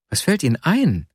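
a sharp, offended what-are-you-saying; indignant surprise. A narrator's reading. Nastjenka, stung by his words, protesting in indignant surprise. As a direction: voice jumps up in startled protest. She bristles at his remark.

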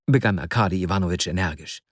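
ordinary delivery

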